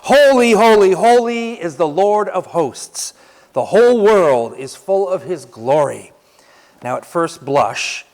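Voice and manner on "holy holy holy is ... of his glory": preacher repeating the seraphs' cry; proclaiming again. Shown as a demonstration. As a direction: lead the congregation in praise. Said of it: "I'm gonna say it one more time".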